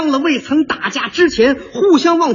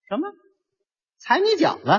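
high, softer sneering retort; cold sneer; 7 semitones higher, 6 dB quieter than usual. Shown as a demonstration. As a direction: throw it back with a cold smile. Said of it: "就用着这个冷笑了".

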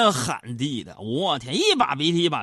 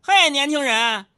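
mock-scolding auntie, higher and louder; aunty's retort; 9 semitones higher, 6 dB louder than usual. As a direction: lecture the young man like a know-it-all grandma. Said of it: "大妈跟我说".

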